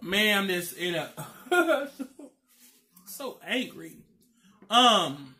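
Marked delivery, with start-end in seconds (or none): 1.5-2.1 teary laugh. Real, not acted. Laughing and crying, upset.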